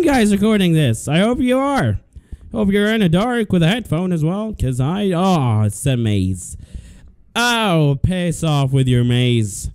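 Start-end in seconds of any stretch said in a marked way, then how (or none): none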